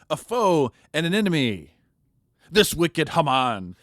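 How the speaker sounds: name the villain with slow force; denouncing. Acted. Esther denouncing Haman before the king, slow and loud.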